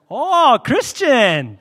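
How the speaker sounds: very slow, drawn-out, high and loud, teasing; amused merchant. Retold or imitated. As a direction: stretch it out like a shopkeeper who is amused and impressed. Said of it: "and the guy goes".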